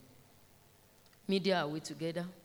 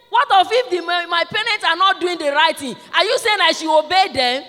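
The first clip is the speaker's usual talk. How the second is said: put-on very high, loud child's objection; challenging child; 10 semitones higher, 12 dB louder than usual. Child asking teacher about wrongdoing parents, high loud challenge. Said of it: "He said".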